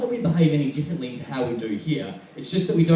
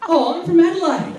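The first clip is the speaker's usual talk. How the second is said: much higher, louder put-on girl's voice; girl's reply; 5 dB louder than usual. Girl answering his chat-up in a nightclub, high-pitched mimicry.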